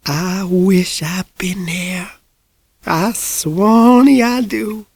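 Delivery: put-on frail drawn-out voice of a very old woman; ancient old woman. A narrator's reading. Hundred-year-old lady talking to mother, frail and slow.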